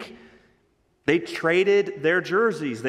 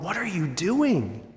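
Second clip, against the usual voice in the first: exasperated question; 4 semitones higher, slower than usual. Retold or imitated. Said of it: "You hear the tone".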